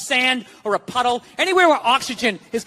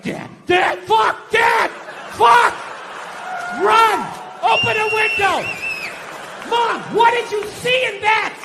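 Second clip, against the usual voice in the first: put-on panicked kid yelling; 8 semitones higher, slower than usual. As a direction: scream it like a scared kid in a smoky house. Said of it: "I was like".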